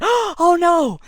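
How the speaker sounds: gasp, then a loud high cry; acted shock, loud and high; horrified gasp. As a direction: gasp it out in alarm. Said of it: "And I gasped".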